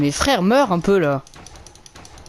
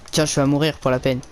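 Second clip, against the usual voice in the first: normal talking voice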